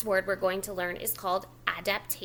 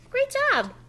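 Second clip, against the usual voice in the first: much higher, louder "Great job"; cheery praise; 13 semitones higher, 8 dB louder than usual. Real, not acted. Teacher praising the child, higher and louder. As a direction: burst out with bright praise. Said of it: "praise after the child's try".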